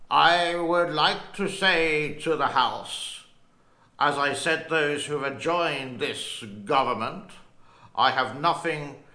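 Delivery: slow, booming British Churchill voice with gruff bahs; Winston Churchill accent. Retold or imitated. Vlogger doing Churchill's wartime speech, slow booming British accent. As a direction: boom it out like Churchill addressing the House. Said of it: "I got to put in my Winston Churchill accent".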